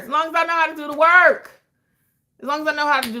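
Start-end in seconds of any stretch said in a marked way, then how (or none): 0.0-1.6 loud, high-pitched, hammed-up declaration; emphatic holler. Real, not acted. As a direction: holler it, stretching the word work. Hammed-up first take, then repeated calmer.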